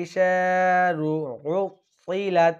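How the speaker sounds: উতিলাত with a thick, heavy ত; slow recitation, ত in উতিলাত made full and heavy. Shown as a demonstration. Teacher showing students the heavy ত. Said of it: "ত শব্দটি একটু মোটা করতে হবে".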